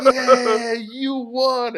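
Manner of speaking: very high, loud mock cheering; cheering crowd. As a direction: imitate an audience going wild in falsetto. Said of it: "And the crowd goes".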